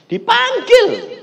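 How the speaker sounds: high, loud, drawn-out shout; a slow, booming high yell. Retold or imitated. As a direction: fill the whole room with it. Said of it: "teriaknya seluruh ruangan".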